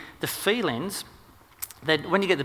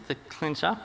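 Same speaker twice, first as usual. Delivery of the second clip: ordinary talk, nothing marked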